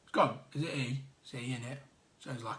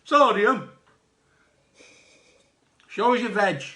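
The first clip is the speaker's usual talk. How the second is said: imitating Ed; 5 semitones higher, 8 dB louder than usual. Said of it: "Ed's like".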